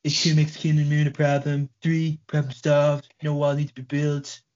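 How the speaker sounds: hammed-up blustering politician retelling the joke; Donald Trump. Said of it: "That sounds like Donald Trump".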